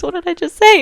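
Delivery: high, loud disbelief at yourself; incredulous. Real, not acted. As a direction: question your own slip in a high, loud voice. Baffled by her own slip.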